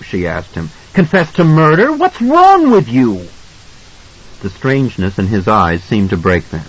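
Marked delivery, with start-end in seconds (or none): 0.9-3.3 high, incredulous challenge; angry wife. A narrator's reading. Wife challenges husband's questioning, high angry outrage. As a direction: climb higher into drawn-out outrage. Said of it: "her face pale and angry".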